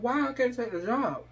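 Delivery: put-on high, pleading girl's question; lightly voiced pleading daughter; whiny plea. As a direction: plead like a hurt kid. Girl pleads for the job.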